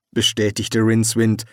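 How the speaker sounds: usual speaking voice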